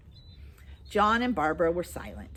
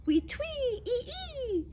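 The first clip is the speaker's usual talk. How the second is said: very high chirping bird call; starling chirp; slower than usual. Retold or imitated. John mimics the starling's call, high and chirpy. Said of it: "the starling says".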